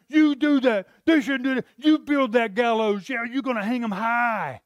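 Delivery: loud, high goading cheer; egging him on. Retold or imitated. Wife and friends loudly urging Haman on. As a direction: whoop them on to build it. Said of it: "they're like".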